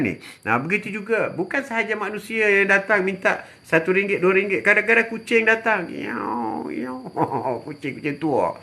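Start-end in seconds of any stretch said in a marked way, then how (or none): 5.9-7.0 imitating a cat's meow, higher and soft. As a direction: meow like a hungry old cat. Imitates a hungry cat. (tiruan bunyi kucing mengiau)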